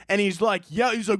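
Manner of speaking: usual speaking voice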